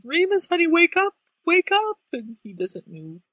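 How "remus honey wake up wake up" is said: high, loud frantic pleading. Retold or imitated. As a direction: shake him awake in panic. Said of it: "she rushes in and".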